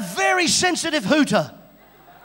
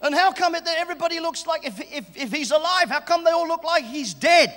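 normal talking voice

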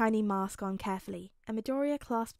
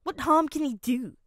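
higher, loud, dismissive question; scoffing; 4 semitones higher, 9 dB louder than usual. A narrator's reading. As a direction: toss it out scornfully. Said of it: "Mineta scoffed".

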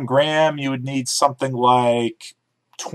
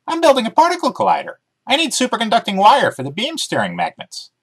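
higher, quick puppyish voice; chirpy dog character, keen to explain; eager talking dog; 11 semitones higher than usual. Acted. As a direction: bounce through it like an excited dog. Dog Emmy voiced in story.

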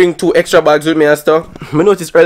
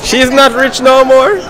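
playful mocking tease; teasing; 10 semitones higher, 5 dB louder, slower than usual. Real, not acted. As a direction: tease her, sing-song and slow. Teasing the rich woman.

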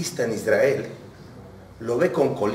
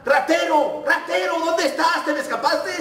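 very high, strained mock yell; shouting. Taxi driver yells after the vanished passenger, shouting. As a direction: call out in high panic after a thief. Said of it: "le empecé a gritar".

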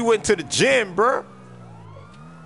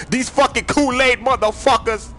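hyped shout; 8 semitones higher than usual. Acted. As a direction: fire the crew up to take the streets. Gang boss hyping crew.

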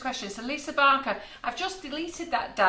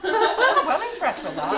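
laughing; 9 semitones higher, 9 dB louder, slower than usual. Real, not acted. Gushing over clever technique.

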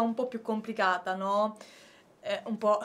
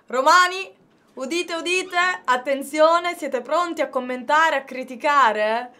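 loud, high proclamation to the crowd; town crier; 3 semitones higher, 6 dB louder than usual. Acted. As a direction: announce it like a herald in the square. Proclaims to Romans, udite udite.

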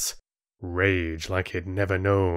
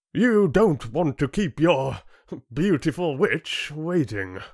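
mocking chieftain; 8 semitones higher than usual. Chieftain dismissing boy before laughing council, mocking. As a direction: tease him for the crowd's amusement.

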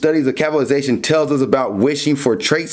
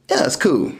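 indifferent whisper. Acted. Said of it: "they're kind of just like".

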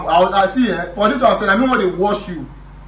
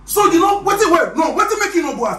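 shocked outburst; 10 semitones higher than usual. Acted. Suddenly realizing the boy never went home, shocked. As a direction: burst out in disbelief.

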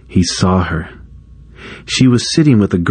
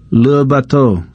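a higher class chorus echo; students repeating; 5 semitones higher than usual. A narrator's reading. Students repeating after teacher, higher echo. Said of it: "the students repeated".

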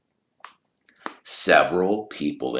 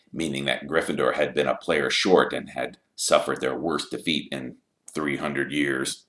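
ordinary delivery, nothing marked

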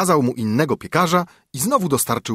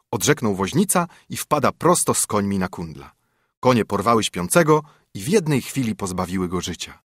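ordinary delivery, nothing marked

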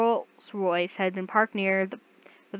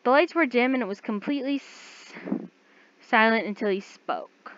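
plain reading voice

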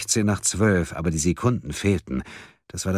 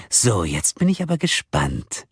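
swooping, mock-curious taunt; metallic voice; 3 semitones higher than usual. A narrator's reading. Kidnapper awaiting the group's decision, metallic taunting. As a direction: toy with them gleefully. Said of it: "die metallische Stimme".

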